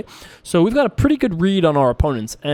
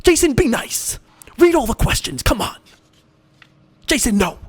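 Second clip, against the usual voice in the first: higher, nagging mom voice; scolding mom impression; 8 semitones higher than usual. Mom scolding Jason to read every question, higher nagging voice. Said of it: "My mom would be way more aggressive".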